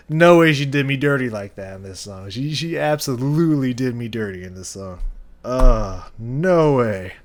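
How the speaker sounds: loud playful indignation; loud real outrage. Real, not acted. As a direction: shout it like the band betrayed you. Outraged at song repeating chorus.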